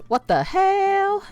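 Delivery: HELL drawn out very high; high, stretched mimicry of a little boy; son's what-the-hell. Mom imitating her boy's favorite phrase, high drawn-out HELL. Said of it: "he says it like that".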